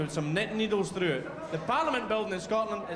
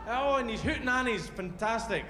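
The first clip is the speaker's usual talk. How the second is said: ordinary talk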